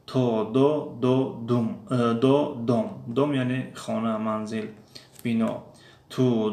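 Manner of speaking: slow, clear, separate takes of تو and دو, with a voiceless ت in تو and a voiced د in دو; teacher's pronunciation drill. Shown as a demonstration. Teacher drilling viewers on Russian т and д, slow and clear. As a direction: sound each syllable deliberately, setting ت against د. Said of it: "گفتن فرقیت بین ت و د".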